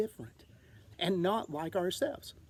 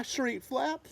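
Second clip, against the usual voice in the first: ordinary delivery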